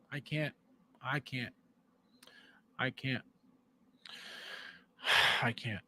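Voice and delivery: trailing off, worn out by it all; exasperated quiet. Real, not acted. Exasperated ranting fades out.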